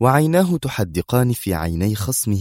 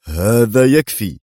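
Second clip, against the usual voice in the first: slow, louder stern command; 3 semitones higher, 5 dB louder, slower than usual. A narrator's reading. Director halts his two quarrelling officers, sternly. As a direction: put a firm stop to it, drawn out. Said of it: "يملأ صدره بالهواء قائلا في صرامة".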